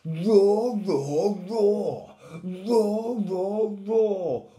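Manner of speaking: loud, high, swooping roars; lion cubs roaring. A narrator's reading. Lion cubs waking up together, loud roaring. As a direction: roar out each word like young lions. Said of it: "waking up and roaring".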